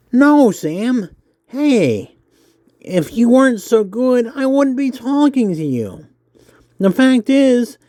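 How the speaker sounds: high nerdy interviewer voice. Acted. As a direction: pitch it up, eager and nerdy. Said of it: "I hope my nerdy voice isn't too nerdy".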